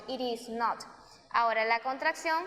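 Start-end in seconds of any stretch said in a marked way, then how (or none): 0.1-0.8 "It is not" with the t of "It" said like a quick Spanish r, "ir is not"; "It is not" sounding like "ir is not"; flapped t. Shown as a demonstration. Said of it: "Esa T se convierte como una R".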